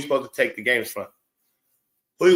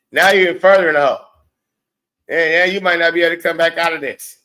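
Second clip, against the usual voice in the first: fast, loud and higher, piling on the scorn; heated rant; 5 semitones higher, 9 dB louder, faster than usual. Real, not acted. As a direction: spit it out fast like a rant building up. Ranting at Fee.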